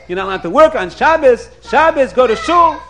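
loud, rising scolding shout; hammering rabbinic rebuke; retold screaming. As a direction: shout an order in mock outrage. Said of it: "the Rav starts screaming".

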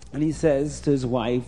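ordinary delivery, nothing marked

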